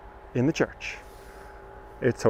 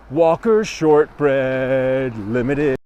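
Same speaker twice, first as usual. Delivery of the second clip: slow, drawn-out announcer read; grand announcement; 3 semitones lower, much slower than usual. Pretend. Guide unveiling the shortbread factory to viewers in a slow announcer voice. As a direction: present the name like a grand unveiling.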